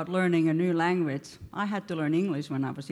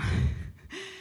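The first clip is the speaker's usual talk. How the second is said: breathy giggle; 12 semitones higher, 3 dB quieter than usual. Real, not acted. Laughing while telling funny story.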